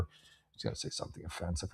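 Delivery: whispered aside. Real, not acted. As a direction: drop to a whisper as if letting the audience in on a secret. Confides an offensive thought aside.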